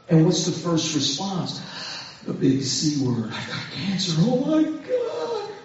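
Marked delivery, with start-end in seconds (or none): 3.3-5.5 freaking out. Acted. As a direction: act out a dismayed first reaction. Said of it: "We freak out".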